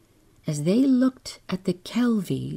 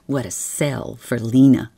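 ordinary delivery

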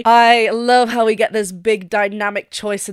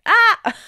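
high reaching ah; 12 semitones higher, 6 dB louder than usual. Shown as a demonstration. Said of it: "and then, like, leave it up there".

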